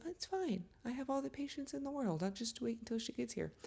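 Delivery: high, soft mock-casual husband voice; lightly sing-song, playing the oblivious husband. Husband's impatient-free attitude mocked.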